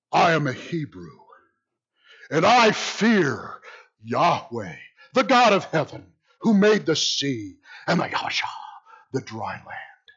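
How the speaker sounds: grave, spaced-out scripture reading; solemn declaration. Acted. Jonah's solemn declaration read dramatically.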